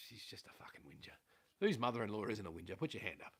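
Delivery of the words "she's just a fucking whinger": a secret jab, barely voiced; whispered aside. Real, not acted. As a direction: whisper it under your breath. Muttered insult about mother-in-law.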